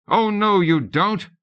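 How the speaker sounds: high, loud playful shout. A narrator's reading. Tutor shouting at the charging children, playful defiance. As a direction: roar it back at the charging kids. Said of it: "Loren shouted".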